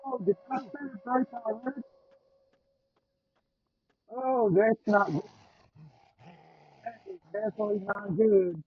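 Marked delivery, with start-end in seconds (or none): 4.2-8.6 rising alarm, louder each time; getting more and more worried. Real, not acted. Enemy appears, alarm rising.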